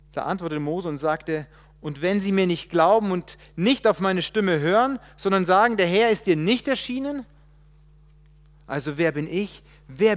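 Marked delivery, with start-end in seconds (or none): 1.8-7.3 louder, higher worried objection; anxious plea. Acted. Moses objecting to God that nobody will believe, louder. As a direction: push the fear outward.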